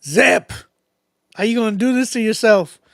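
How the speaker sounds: loud, teasing call-out. Real, not acted. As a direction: call him out loudly, half-laughing. Calling out to struggling guest.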